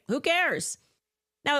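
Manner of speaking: ordinary talk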